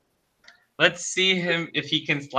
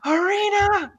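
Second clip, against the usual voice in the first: mock scream; 14 semitones higher than usual. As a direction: cry out the name in a held-back, high-pitched scream. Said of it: "he runs up the staircase screaming".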